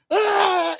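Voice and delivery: high, held imitation wrestler yell; high drawn-out "aah"; mock battle cry. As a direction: answer with a high-pitched war cry. Imitating both wrestlers yelling.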